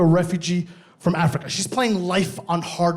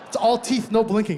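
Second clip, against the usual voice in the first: usual speaking voice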